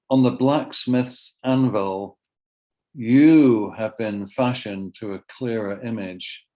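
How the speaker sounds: slow, measured poetry reading; poem reading. Acted. Poet reads the poem for his son turning forty, measured poetry reading. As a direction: give each phrase room, firm onsets.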